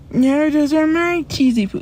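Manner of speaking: high, whiny cartoon kid impression; Eric Cartman. Streamer imitating Cartman over his snacks, high cartoon impression. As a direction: put on a high cartoon voice, cut off midway. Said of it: "That's how he talks".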